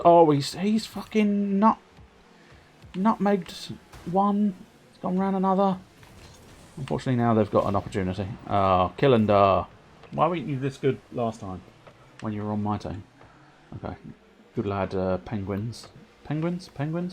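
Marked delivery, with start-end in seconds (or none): 0.0-5.8 higher, drawn-out amazed exclamation; stunned delight at a teammate's trick. Real, not acted. Amazed at a skilful move. 16.2-17.1 higher, rising Penguins?; amused disbelief at Penguins?; incredulous. Real, not acted. Incredulous at the team name.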